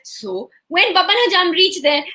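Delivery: ordinary delivery, nothing marked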